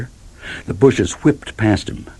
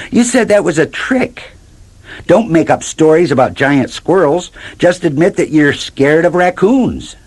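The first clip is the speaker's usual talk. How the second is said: Queenie's voice; 9 semitones higher than usual. A narrator's reading. Queenie scolding.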